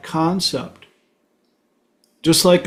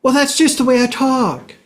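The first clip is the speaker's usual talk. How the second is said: barista voice; 9 semitones higher than usual. Retold or imitated. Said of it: "barista defending her voice".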